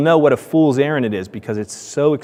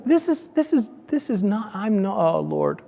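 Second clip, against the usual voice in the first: high, flustered sputtering; flustered protest; 7 semitones higher, 4 dB quieter than usual. Retold or imitated. Refusing to believe the van is creepy, high sputter. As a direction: sputter in disbelief, losing words. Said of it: "I'm like going".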